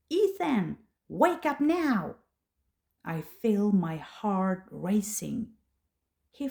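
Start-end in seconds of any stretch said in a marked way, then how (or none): 0.1-2.2 raised, higher call to wake him; calling loudly. Acted. Mother calling her son to wake up, loud. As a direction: call the boy out of bed, loud. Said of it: "I call his name loudly".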